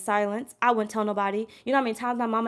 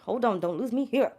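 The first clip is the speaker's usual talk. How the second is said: ordinary delivery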